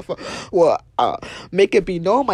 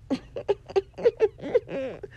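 laughter after a jab; 9 semitones higher, 3 dB quieter than usual. Real, not acted.

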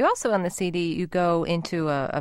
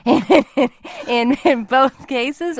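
laughing through the question; words broken by laughter; 7 semitones higher, 8 dB louder, much slower than usual. Real, not acted. Host laughing mid-question.

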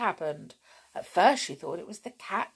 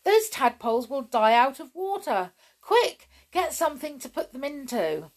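higher, urgent storybook alarm; urgent order; 7 semitones higher, 5 dB louder than usual. A narrator's reading. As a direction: call out a sudden, urgent instruction. Urgent order to save the tadpoles.

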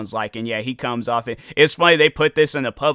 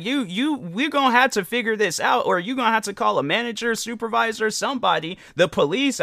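insistent demand; 8 semitones higher than usual. Retold or imitated. Imagined demand to airport staff.

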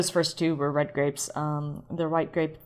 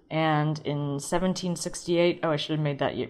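usual speaking voice

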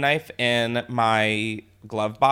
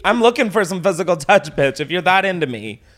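mocking taunt; 4 semitones higher, 5 dB louder than usual. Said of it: "And I was like".

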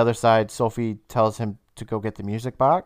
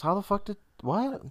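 confused; 9 semitones higher, 5 dB quieter than usual. Real, not acted. Sudden confused outburst.

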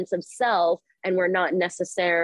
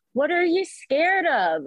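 gentle asking; 9 semitones higher, slower than usual. Shown as a demonstration. Speaking gently to the fight part.

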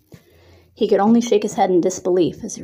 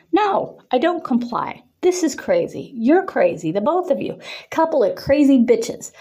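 snapping; 6 semitones higher than usual. A narrator's reading. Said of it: "Cody snapped".

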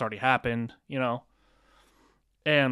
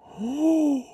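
mock gasp; 13 semitones higher, 6 dB louder than usual. Reacting to the revelation.

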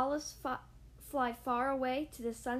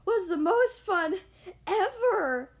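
much louder, thrilled and out of breath; breathless excitement; 6 semitones higher, 10 dB louder, slower than usual. Acted. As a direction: shout the joy breathlessly. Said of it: "said Squirrel breathlessly".

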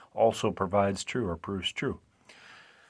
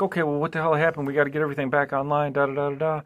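flustered scientists; 6 semitones higher, faster than usual. Retold or imitated. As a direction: rattle it off like a panicked lab team. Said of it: "So now everybody's like".

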